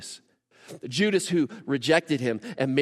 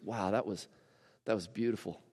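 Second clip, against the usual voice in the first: soft, quietly moved murmur; acted hushed appreciation, gently touched; tender awe; 3 semitones lower, 8 dB quieter than usual. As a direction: say it softly, as if deeply moved. Imagined Jesus moved by gratitude.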